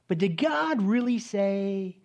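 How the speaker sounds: very slow, sly put-on tempter voice; Satan's question. Preacher voices Satan tempting believers, very slowly and slyly. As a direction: drag it out like the serpent. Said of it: "See if this sounds familiar".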